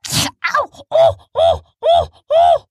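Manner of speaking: very high, loud yelps of pain; tripping over gold blocks; squeaky yelping ow; shouted. Acted. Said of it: "he shouted as he fell".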